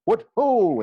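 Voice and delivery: hearty shout. Acted. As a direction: bellow it like a knight in armor at a tournament. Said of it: "armor and shouted".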